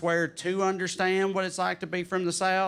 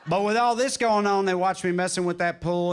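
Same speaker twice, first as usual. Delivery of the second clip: ordinary talk, nothing marked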